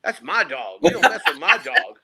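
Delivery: mock tough protective owner; protective outburst. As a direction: stake your claim, don't mess with mine. Defending dog from stranger.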